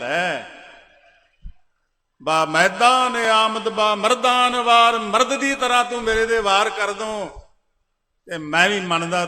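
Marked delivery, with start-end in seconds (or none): 2.2-7.3 ringing challenge. Acted. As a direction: call him out to fight like a warrior. Guru's challenge voiced loudly. (ਉੱਚੀ ਲਲਕਾਰ ਵਾਂਗ ਵੰਗਾਰ)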